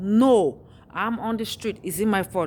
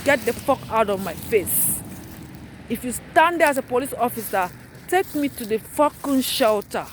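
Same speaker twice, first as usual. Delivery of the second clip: real fury; 3 semitones higher than usual. Real, not acted. Homeless mother cursing the police officer, furious shout. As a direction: tell the officer off, voice climbing. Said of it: "I'm so fucking angry".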